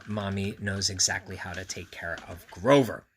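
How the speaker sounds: ordinary delivery, nothing marked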